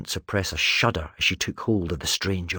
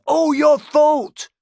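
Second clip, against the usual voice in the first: very high, loud, slow shout; screaming; 9 dB louder, much slower than usual. Acted. Talon screaming blame at Erasmus. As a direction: drag out a furious scream. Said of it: "Talon screamed".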